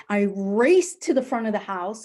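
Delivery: ordinary talk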